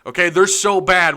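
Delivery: mock the gloating rival fan with fake sympathy. Host imitating an Oklahoma fan gloating over Arkansas, mock pity. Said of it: "they're gonna come in".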